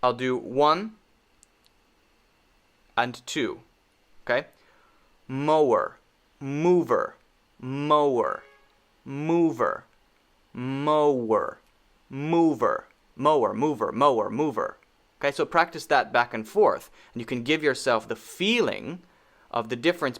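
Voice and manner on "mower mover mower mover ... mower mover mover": "mower" with rounded lips, "mover" with lip on teeth; W versus V contrast. Shown as a demonstration. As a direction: alternate "mower" and "mover", keeping the sounds distinct. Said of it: "for comparison".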